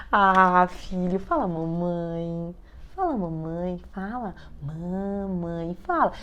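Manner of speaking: súplica doce, bem baixinha e arrastada; peça devagar, com todo carinho, quase implorando baixinho; carinhosa. Acted. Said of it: "falando com todo carinho". (com todo carinho, baixinho e devagar)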